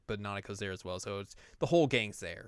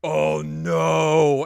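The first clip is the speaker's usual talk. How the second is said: frightened gasp; 5 semitones higher, 11 dB louder than usual. Acted. Naoto turns to see Yu.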